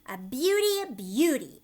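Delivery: loud, high cry of delight; greedy delight. Acted. Aunt Sponge crying out at the peach. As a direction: gloat over the fruit. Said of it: "Aunt Sponge cried out".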